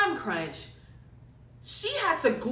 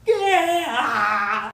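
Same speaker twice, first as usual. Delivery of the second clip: loud, long crying wail; mock-crying wail; 3 semitones lower, 8 dB louder than usual. Real, not acted. Client upset over what she paid, loud crying wail. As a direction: break off into a drawn-out wail. Breaks down over glued-in hair.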